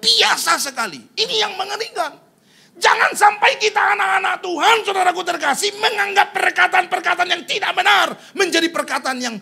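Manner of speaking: high, loud warning shout; impassioned pulpit shout; full-force preaching. Real, not acted. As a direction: warn the congregation at full volume.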